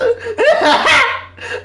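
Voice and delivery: rising laugh. Real, not acted. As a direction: burst of high giggling. Laughing at a funny clip.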